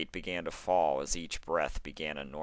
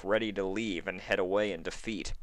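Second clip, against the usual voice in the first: plain reading voice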